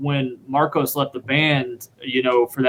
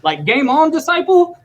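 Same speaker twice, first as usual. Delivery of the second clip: much higher pitched, incredulous question; amazed question; 12 semitones higher, 4 dB louder than usual. Retold or imitated. Wov recognizing the drummer's band, high amazed voice. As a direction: mimic a hero floored by a fan. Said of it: "he goes, he goes".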